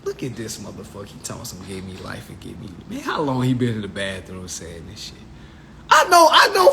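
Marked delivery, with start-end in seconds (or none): 0.0-2.7 low, quiet, fast mocking mutter; mocking aside. Courtroom onlookers mocking the patriot, quiet fast sneer. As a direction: sneer under your breath at the fool. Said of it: "folks was like".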